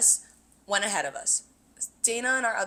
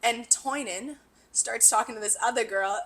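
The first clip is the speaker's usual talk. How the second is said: ordinary talk, nothing marked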